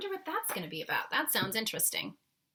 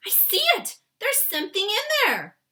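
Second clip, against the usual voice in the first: put-on high, loud, thrilled little girl; excited cry; 14 semitones higher, 12 dB louder than usual. Sophie cries out to the BFG, high and excited. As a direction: Sophie spots it and squeals. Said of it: "cried out".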